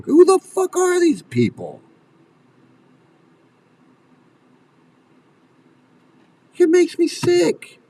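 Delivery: very high, exasperated fury; indignant outburst, pitch way up; real disgusted outrage. Real, not acted. Disgusted outburst at the cult leaders.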